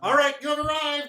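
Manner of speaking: high, loud chirpy gnome. Acted. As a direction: announce arrival like an eager gnome. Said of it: "I like the gnome voice".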